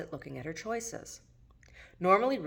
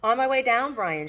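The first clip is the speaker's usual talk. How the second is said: loud call down the stairs, higher; 8 semitones higher, 7 dB louder than usual. Acted. Ruth calling down to Brian at the door, loud. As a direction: shout it down to the door. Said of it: "she yelled".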